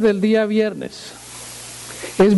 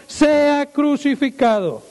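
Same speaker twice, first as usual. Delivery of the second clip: crowd yelling; 9 semitones higher, slower than usual. Acted. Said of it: "ellos gritaban aún más".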